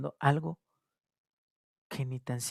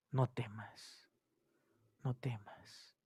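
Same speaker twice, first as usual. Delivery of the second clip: soft reassurance; 3 semitones lower, 12 dB quieter, slower than usual. Acted. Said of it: "lo que está diciendo nuestro Señor".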